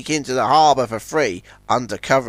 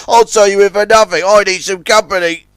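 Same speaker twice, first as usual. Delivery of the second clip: raised, loud, eager offer; eager Zoran; 6 semitones higher, 7 dB louder than usual. A narrator's reading. Zoran offers a free tow for company, eager. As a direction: jump at the chance of company.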